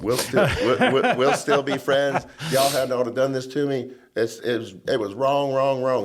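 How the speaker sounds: half-laughing imitation of a furious lawmaker; retold screaming. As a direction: ham up the angry legislator, laughing as you tell it. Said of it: "and he's screaming".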